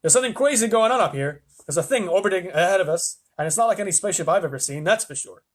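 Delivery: mildly alarmed radio report; excited report. A narrator's reading. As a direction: report something crazy to base. Astronaut reports a strange craft.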